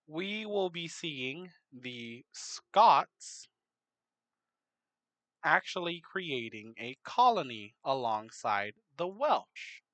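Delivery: ordinary delivery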